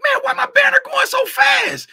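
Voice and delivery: very high, loud comic squawk; mock bewilderment. Streamer re-enacts puzzling over the speeding banner, mock high squawk. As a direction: squeal the complaint in exaggerated disbelief. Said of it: "I'm like".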